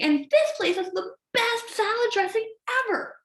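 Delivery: high-pitched gushing delight; really excited. Acted. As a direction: marvel as if at a treasure trove. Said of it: "I got really excited about the things".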